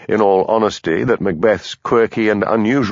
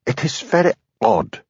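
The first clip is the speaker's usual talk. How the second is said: nervous Highland lilt, higher; a Highlander pressed under questioning; stronger Highland accent; 9 semitones higher than usual. A narrator's reading. Hamish pleading before his boss, in thick Highland accent. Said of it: "his Highland accent strengthened by his nervousness".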